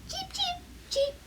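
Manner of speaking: tiny chick cheeps. Acted. Baby chicks hatching on head.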